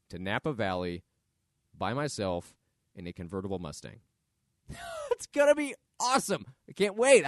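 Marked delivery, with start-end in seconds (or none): 5.1-7.3 high, loud, giddy burst; laugh, then gush with anticipation; thrilled, voice leaping up; real excitement. Real, not acted. Giddy about his coming trip.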